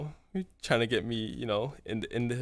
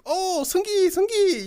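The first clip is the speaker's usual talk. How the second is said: high-pitched mock girl calling his name; hammed-up eager girl, much higher; girly greeting; 7 dB louder, slower than usual. Girl greets him at her door.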